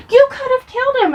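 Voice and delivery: high, fast, acted alarm; freaking-out mom. Mom scolding child over the kick, freaking out. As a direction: blurt it in mock horror. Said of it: "My mom is freaking out".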